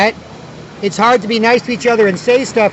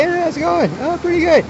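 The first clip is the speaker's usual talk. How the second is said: high friendly greeting; 8 semitones higher than usual. Retold or imitated.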